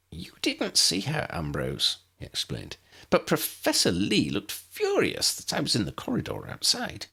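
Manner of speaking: a lightly high little professor, uneasy; Flitwick, worried. Acted. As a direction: voice the small professor fretting to Ambrose. Narrator voices Flitwick.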